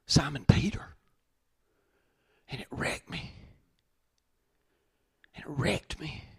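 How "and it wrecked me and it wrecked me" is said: whispered, overcome with emotion; hushed whisper. Real, not acted. Preacher overcome, whispering to the congregation. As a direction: breathe the words, barely voiced.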